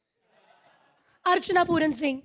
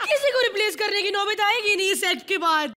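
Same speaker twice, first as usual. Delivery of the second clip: ordinary talk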